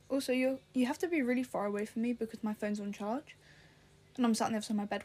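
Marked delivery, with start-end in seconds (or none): none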